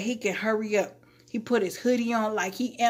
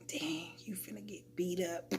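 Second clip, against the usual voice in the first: hushed mock onlooker whisper; whispered warning; 17 dB quieter than usual. Guys watching the coworker, imitated whispered warning. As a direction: whisper it like a bystander sizing up trouble.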